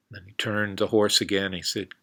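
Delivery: ordinary talk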